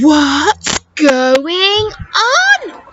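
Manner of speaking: put-on slow, amazed boy's voice; astonished. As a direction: stretch it out in wonder. Boy astonished at window.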